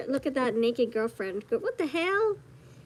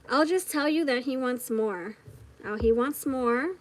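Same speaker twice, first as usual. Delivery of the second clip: normal talking voice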